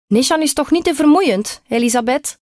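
high-pitched call towards the kitchen. A narrator's reading. Boy's mother calls to grandmother in the kitchen, high-pitched call. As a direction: call a question across to someone, pitch well up. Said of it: "riep ze richting keuken".

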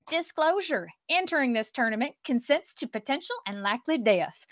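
hammed-up Southern sponsor read; swooping, put-on Oklahoma Southern twang; Southern accent. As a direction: sell it like a Southern fairground announcer. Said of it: "but today I'm gonna have me a Southern accent".

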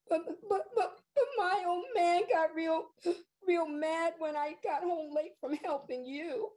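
high, stammering through sobs; a crying child blurting it out; tearful boy choking on the words; sobbing boy. Acted. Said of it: "he sobbed".

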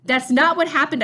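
loud, high-pitched sudden objection; blurted out. A narrator's reading. Sam interrupting the men about Bucky, blurted out. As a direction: burst in to contradict. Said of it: "I blurted".

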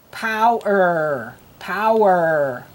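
slow "power" with a long OW; drilling OW words. Shown as a demonstration. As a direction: stretch both parts of "power", falling. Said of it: "words that have ow".

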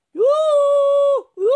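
loud high drawn-out hooting; owl cry. Acted. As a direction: hoot out two long calls like an owl. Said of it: "il gufo gridò a sua volta". (grido di gufo, acuto e prolungato)

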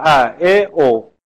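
each short vowel sounded alone, clearly; name the short vowels one by one; vowels sounded out for students; short vowels demo. Shown as a demonstration.

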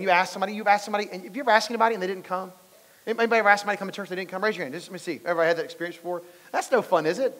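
ordinary talk

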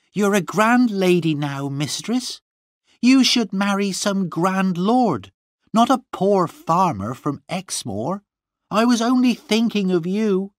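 very high, insistent servant's voice; protesting servant. A narrator's reading. Gwenny justifying herself to her mistress, high protest. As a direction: plead your good intentions, pitched high.

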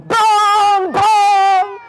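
long, loud, high bong; big resonant bongs, held long; imitated clock chime. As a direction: ring out like a striking clock, drawn out. Imitates clock striking two.

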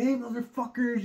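high hammed-up yell at the crowd; mock hype yell from a truck stage. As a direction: shout it out to the whole crowd. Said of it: "fucking yelling at people".

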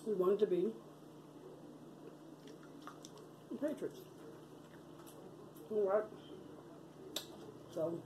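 chewing while talking; eating between words; mouth full of fries. Real, not acted. Seen 1: Bites fry just before, fry at mouth at 0.8, jaw working.

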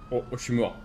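ordinary talk

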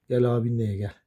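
ordinary talk

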